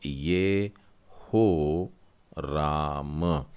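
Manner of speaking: very slow, drawn-out ye-ho ram; slow syllable-by-syllable demo. Shown as a demonstration. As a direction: stretch out ये हो राम syllable by syllable. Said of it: "आखिरी शब्द जो है नौवां वाला".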